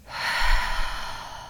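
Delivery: long breathy sigh out the mouth; audible exhale sigh. Shown as a demonstration. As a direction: let a deep breath go with a sigh. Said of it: "sigh them out our mouth".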